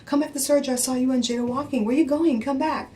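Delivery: lightly voiced high husband pleading; a hint of a guilty husband calling them back; imitating husband calling. Wife mimicking husband on the phone, lightly pleading. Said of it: "he called and goes".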